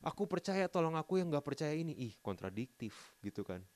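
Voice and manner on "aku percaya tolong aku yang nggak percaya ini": high, loud mock of a contradicting voice; mock impatient God, higher and louder; mocking Jesus voice. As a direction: mimic the complaint, much higher. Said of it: "Tuhan nggak bilang kayak".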